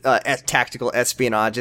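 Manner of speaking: ordinary talk, nothing marked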